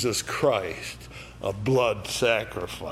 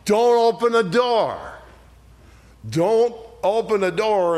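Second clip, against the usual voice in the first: higher and louder urgent warning; urgent shout; 8 semitones higher, 4 dB louder than usual. Acted. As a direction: cry out the warning to Cain. Preacher pleading with Cain.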